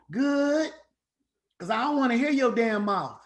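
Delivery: a lightly hammed teenage daughter; sassy daughter. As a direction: play the fed-up kid snapping back. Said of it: "How you doing at school?".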